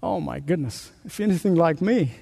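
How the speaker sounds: ordinary delivery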